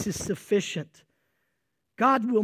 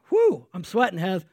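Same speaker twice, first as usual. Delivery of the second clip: usual speaking voice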